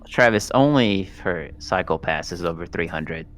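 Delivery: usual speaking voice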